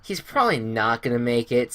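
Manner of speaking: normal talking voice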